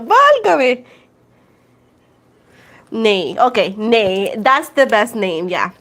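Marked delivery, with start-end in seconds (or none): 0.0-0.8 high, loud exasperated válgame; exclamación exagerada; mock exclaim. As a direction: throw up your hands, Válgame!.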